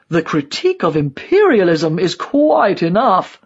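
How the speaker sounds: lightly voiced old die-hard, curtly final; emphatic character voice, dismissive; old ideologue. A narrator's reading. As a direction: shut the question down as a dogmatic old man.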